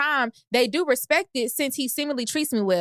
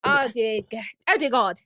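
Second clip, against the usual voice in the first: put-on London accent; 3 semitones lower than usual. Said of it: "You sound like Dorit".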